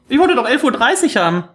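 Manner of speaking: dismayed protest. Real, not acted. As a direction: blurt out a dismayed complaint. Discovers the wrong ticket time. (bestürzter, hoher Protest)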